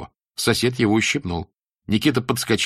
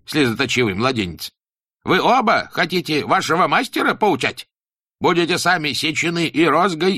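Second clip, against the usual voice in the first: stern teacher voice; 6 semitones higher, 4 dB louder than usual. Acted. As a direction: preach it like a strict old teacher. Teacher scolds pupils.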